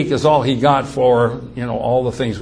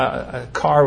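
plain reading voice

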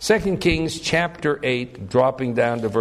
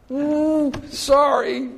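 mock sheepish; 12 semitones higher, much slower than usual. Acted householder hiding the spies.

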